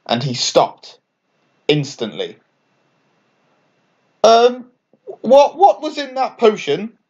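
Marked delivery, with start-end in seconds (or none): 4.2-7.0 much higher, louder stammering worry; fearing what comes next; high nervous stammer; asked nervously. A narrator's reading. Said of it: "Dot asked nervously".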